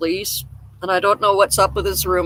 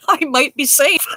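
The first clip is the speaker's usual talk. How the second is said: higher voice breaking out of laughter; laughing punchline; 6 semitones higher than usual. Real, not acted. Smoker joking about own leather lungs, laughing. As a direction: get the words out between real laughs.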